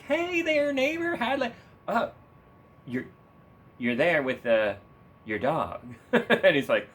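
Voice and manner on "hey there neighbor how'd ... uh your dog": very high, chirpy Flanders impression. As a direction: squeak out a friendly neighbor greeting.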